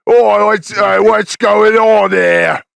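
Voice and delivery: mock the drummer in a terrible British accent; bad British accent. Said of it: "the worst fake British accent".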